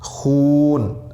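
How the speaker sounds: खून with the ऊ stretched very long. Shown as a demonstration. Teacher demonstrating the long vowel of waw maroof. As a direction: drag out the ऊ in खून very slowly. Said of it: "वाव की आवाज़ खींचकर निकलती है".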